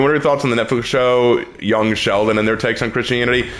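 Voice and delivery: ordinary talk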